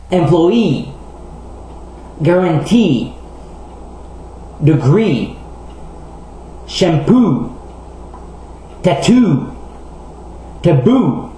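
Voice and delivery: "Employee", "Guarantee", "Degree", "Shampoo", "Tattoo", "Taboo", each stressed on its final long vowel; last-syllable stress. Shown as a demonstration. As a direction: swell on the end of each word, "Employee" to "Taboo". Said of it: "sont généralement accentués sur la dernière syllabe". (de « Employee » à « Taboo », accent sur la dernière syllabe)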